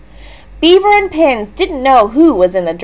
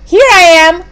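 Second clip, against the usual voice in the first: high, louder call-out; excited cry; 9 semitones higher, 6 dB louder than usual. A narrator's reading. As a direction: call out happily to announce yourself. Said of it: "cried Moosling".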